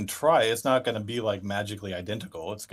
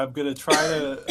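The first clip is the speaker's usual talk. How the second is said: normal talking voice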